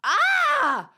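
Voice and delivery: high startled shriek; shocked shriek. Real, not acted. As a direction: yelp in shock. Shocked reaction to toenail news.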